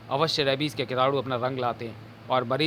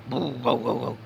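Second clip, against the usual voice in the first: dog barking; slower than usual. Acted. Dogs barking.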